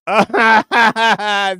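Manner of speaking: high, loud burst of real laughter. Real, not acted. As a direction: crack up out loud. Bursts out laughing reading chat.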